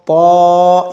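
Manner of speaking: "पौ" as a heavy emphatic T with long rounded vowel; thick-sound demo. Shown as a demonstration. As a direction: hold "पौ" full and level. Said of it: "इस तरह से". ("पौ" को मोटा करके खींचें)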